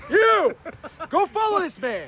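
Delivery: high loud commanding shout. Real, not acted. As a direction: bark the order at chat. Commanding chat to follow.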